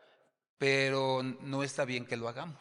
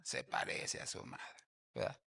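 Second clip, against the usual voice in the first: whispered aside; 8 dB quieter than usual. Retold or imitated. People whispering about a baby's face, hushed. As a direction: lean in and whisper it like gossip.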